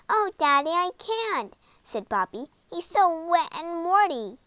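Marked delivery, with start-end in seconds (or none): 0.1-1.6 higher, pleading protest; pleading princess. Acted. Princess pleads with her father. 2.7-4.4 higher-pitched princess complaint; disgusted protest. Acted. Princess protests about the frog.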